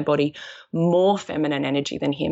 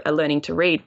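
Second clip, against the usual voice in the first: ordinary delivery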